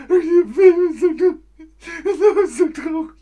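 high, loud hammed-up sobbing; mock crying. Teacher fakes sobbing over a sad movie. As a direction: overdo the tears over a film.

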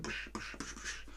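voiceless, repeated percussive tish noises; quick soft hammering sound effect; imitated hammer blows. Shown as a demonstration. Teacher showing nails punching a pot, imitated hammer blows.

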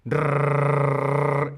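long trilled rrrr with lots of air; rolled double R. Shown as a demonstration. Teacher demonstrating a long rolled trill to students. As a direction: let the tongue vibrate on a long rrrr. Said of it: "una R doble es mucho más aire".

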